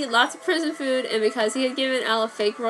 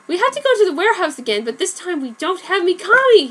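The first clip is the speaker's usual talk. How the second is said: fast, high, loud panicked shout; frantic son shouting the new plan; 9 semitones higher, 7 dB louder than usual. A narrator's reading. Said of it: "he shouted".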